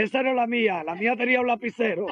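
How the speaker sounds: high-pitched comic objection; acted protest. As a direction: deliver the punchline as the injured man. Punchline in injured man's voice.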